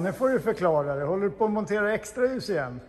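ordinary delivery

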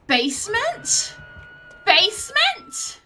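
high, loud, drawn-out questioning call. Real, not acted. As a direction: call it out slowly, voice rising high. Calling out to teammates in game.

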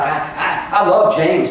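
usual speaking voice

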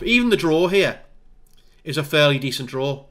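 ordinary talk

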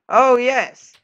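high, loud oh yes; excited cheer. Real, not acted. Player cheering at a game win, excited. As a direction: celebrate the win.

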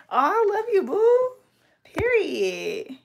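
squealing love to subscriber; gushing squeal. Real, not acted. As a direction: coo it to a new subscriber.

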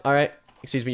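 ordinary talk

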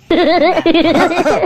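high, loud burst of laughter; high-pitched cackling laugh. Acted. Laughter amid a comic skit.

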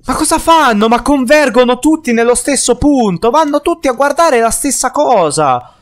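baffled exclamation. Real, not acted. Baffled by enemies converging.

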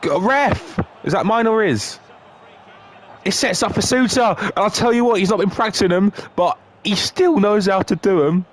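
real excitement; excited commentary. Real, not acted. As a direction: hype up your own player. Excited commentary on own goal.

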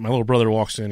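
ordinary talk

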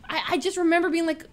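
normal talking voice

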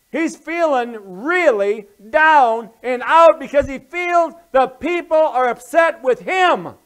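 high, loud, slow preacher's shout; full-force preaching. Real, not acted. Preacher says Samuel is having a crock pot day, shouting. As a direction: build to a shout and hammer the point.

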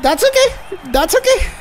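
high-pitched, nervous relief; squeaky reassurance. Real, not acted. Self-soothing after yellow card.

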